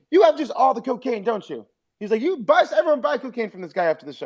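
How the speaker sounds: high mock Burr roast with a laugh; Bill Burr roast. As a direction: tease him about cocaine, high.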